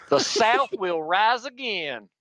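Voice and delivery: slow, drawn-out Southern accent, pitched high; high-pitched Southern accent on request. Shown as a demonstration. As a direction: put on your best Southern accent, stretched out. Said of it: "in your best Southern accent".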